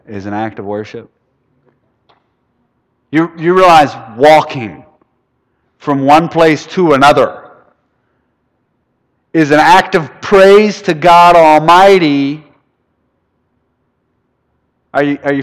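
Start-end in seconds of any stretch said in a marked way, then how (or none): 3.1-12.5 louder, building to a slow, high peak; rising preacher's fervor; emphatic preaching. Real, not acted. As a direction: hammer home each phrase. Youth pastor preaching to students.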